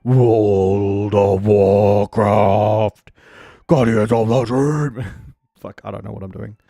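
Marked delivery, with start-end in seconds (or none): 0.1-5.0 low, slow, booming trailer voice; deep trailer voice. Pretend. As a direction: boom it out like a movie trailer. Mock deep announcer chant.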